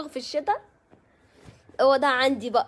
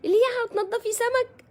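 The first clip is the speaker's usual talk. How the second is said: much higher, shocked mock of the friend; 11 semitones higher, 4 dB louder than usual. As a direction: imitate his appalled question. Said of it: "مش كده الاسلوب". (تقليد صديق مصدوم بصوت أعلى بكثير)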